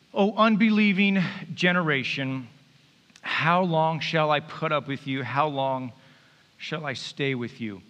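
normal talking voice

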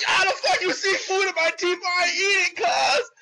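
indignant protest shout. Retold or imitated. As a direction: shout back like an outraged kid wrongly accused. Boy protesting his innocence.

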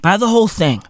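ordinary talk, nothing marked

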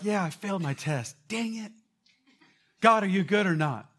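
high, loud mock dismay; mock whiny student. Pastor mocking a student's small crisis, high and loud. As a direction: ham up a petty crisis.

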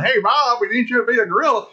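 high, quick mock call; high-pitched hurried summons. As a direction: call him up in an urgent, high voice. Acts out phone call hiring gorilla actor.

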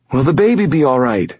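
put-on high, pleading girl's voice; anxious. A narrator's reading. Injured pregnant girl begs reassurance about baby, anxious. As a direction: plead for an answer, pitched high.

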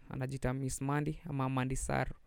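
ordinary delivery

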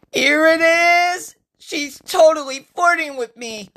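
very high, loud excited outburst; thrilled squeal. Acted. Usuke sure Francesca is flirting, thrilled.

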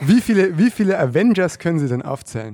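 loud, pushy interviewer; loud reporter question. Pretend. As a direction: fire the question at him. Reporterfrage, gespielt. (laute Reporterfrage)

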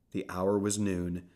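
ordinary delivery, nothing marked

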